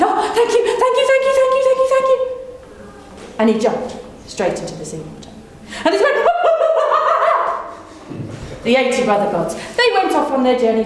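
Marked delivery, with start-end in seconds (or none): 0.0-2.2 high, eager squeaky thanks; grateful rabbit. Acted. Rabbit thanking the tricking brothers, squeaky and grateful. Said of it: "And the rabbit said". 6.2-7.7 mocking laugh. Brothers mock the rabbit.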